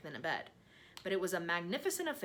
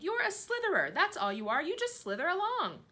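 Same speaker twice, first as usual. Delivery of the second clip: high, louder jeering; taunting; 13 semitones higher, 7 dB louder than usual. A narrator's reading. Centipede jeers at the earthworm, high and loud. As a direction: jeer at him loud and high.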